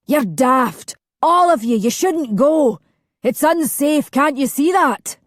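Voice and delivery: shouting. A narrator's reading. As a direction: yell at the departing crowd. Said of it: "who shouted".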